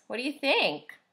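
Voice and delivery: usual speaking voice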